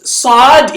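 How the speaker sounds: "Sad" drawn out with a long rounded vowel; letter's full name. Shown as a demonstration. Teacher naming the letter, long loud "Sad". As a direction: stretch "Sad" slowly, like "sawd". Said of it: "is the name".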